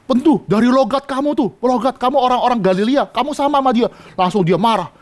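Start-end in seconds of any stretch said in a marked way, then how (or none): 0.0-3.9 higher, insistent accusation; accusing servant girl. Acted. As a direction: needle him about his accent.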